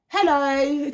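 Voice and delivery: imitating her son's happy hello; child's cheery hello. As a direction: greet a stranger like an eager little boy. Son greets strangers at restaurant.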